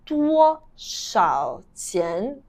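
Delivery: very slow, each syllable set apart; slow demonstration. Shown as a demonstration. Teacher repeating how to ask the price, slow demonstration. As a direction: say it word by word for learners.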